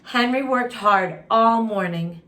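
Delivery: usual speaking voice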